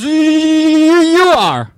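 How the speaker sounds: high, loud eager answer; scared zebra. Pretend. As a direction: give in fast to the lion. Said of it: "And the zebra goes".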